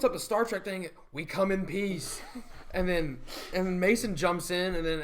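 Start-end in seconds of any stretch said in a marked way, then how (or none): 1.1-2.2 slow solemn alien greeting. Retold or imitated. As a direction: intone it slowly like aliens. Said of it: "like an alien".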